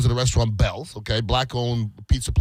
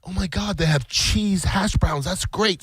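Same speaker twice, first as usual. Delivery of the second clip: put-on higher, bright-eyed retelling of a kid's delight; excited daughter; 6 semitones higher, slower than usual. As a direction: mimic the daughter's excitement over the menu. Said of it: "she's really excited about her cheese hash browns".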